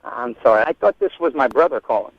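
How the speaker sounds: normal talking voice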